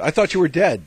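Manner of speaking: high, loud comic exclamation; mock astonishment. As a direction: blurt it out high and loud, hamming it up. Acted quote of a startled exchange.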